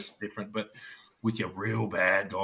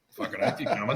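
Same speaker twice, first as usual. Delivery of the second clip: laughing reply. Retold or imitated. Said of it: "And it's like".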